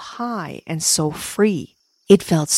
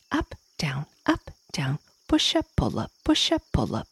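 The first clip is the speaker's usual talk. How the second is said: ladybug exercise coach; 3 semitones lower, 6 dB quieter than usual. Acted.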